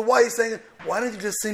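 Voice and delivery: ordinary talk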